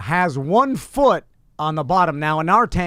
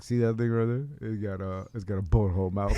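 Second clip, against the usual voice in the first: low, much softer, sly aside; conspiratorial hush; 8 semitones lower, 9 dB quieter, faster than usual. Retold or imitated. Showing his sons the fish, sly low hush. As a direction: lean in and hush it to the kids. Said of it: "I'm like".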